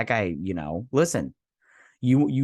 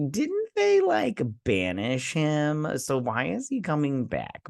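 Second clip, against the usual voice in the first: incredulous; 6 semitones higher, slower than usual. Real, not acted. Incredulous reaction to banished uncle.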